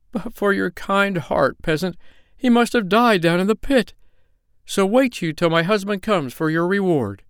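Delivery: high-pitched she-bear voice; grateful bear wife, excited. Acted. Bear wife thanks peasant for saving her cub, high and fervent.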